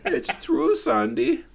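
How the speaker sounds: very high imitated German accent. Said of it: "hear him in the background twice go".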